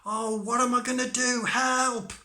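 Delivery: high, loud wail for help; panicked cry. Acted. Tom buried under hay in the stomach, high panicked cry. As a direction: call out in storybook panic.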